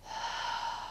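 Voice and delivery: high chest breath let out in a sigh; shallow chest sigh. Shown as a demonstration. Shows the class the wrong breath, chest sigh. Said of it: "is this".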